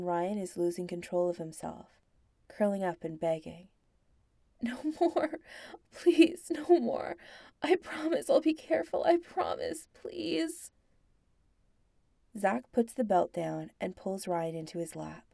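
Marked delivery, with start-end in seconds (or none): 4.6-5.4 high, frantic pleading; desperate begging. Acted. Ryan begging, losing control. 5.9-6.5 high, loud cry of please; desperate loud plea. Acted. Ryan begging, losing control. 6.5-10.7 high, pleading near tears; desperate begging. Acted. Ryan begging, losing control.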